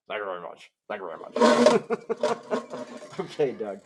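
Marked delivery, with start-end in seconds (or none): 0.1-1.4 lightly voiced mumbled Elvis thank-you, a bit softer; a low-key take on the famous Elvis sign-off; [name]. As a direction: puppet dog's understated Elvis thank-you. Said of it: "impersonation of [name]".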